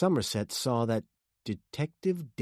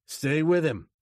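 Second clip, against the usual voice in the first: a raised, higher-pitched shout; shouted order; 7 semitones higher, 6 dB louder than usual. A narrator's reading. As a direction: call out a command. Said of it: "he shouted".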